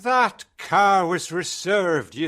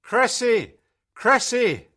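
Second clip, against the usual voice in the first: high, loud calling out of a name; man's shout; 12 semitones higher, 5 dB louder than usual. A narrator's reading. Man running towards his employee, shouting. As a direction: shout out to stop someone. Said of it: "a man shouted".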